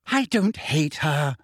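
slow, superior and dismissive; lofty. A narrator's reading. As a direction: Hermione brushing off Ron, lofty and slow. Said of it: "said Hermione loftily".

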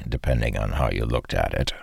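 ordinary delivery, nothing marked

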